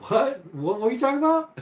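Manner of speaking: high, baffled "What? What are you talking about?"; mock confusion. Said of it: "Definitely like".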